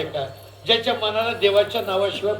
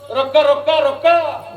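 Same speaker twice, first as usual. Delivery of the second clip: loud, high repeated call; sharp repeated stop command; 5 semitones higher, 6 dB louder, slower than usual. Acted. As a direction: halt the crowd with a shout. Preacher shouts stop to crowd.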